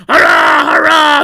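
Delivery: loud high whooping cheer; triumphant mob cheer; roaring cheer. A narrator's reading. Loaded trucks roaring as they hurl down the hill. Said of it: "they roared".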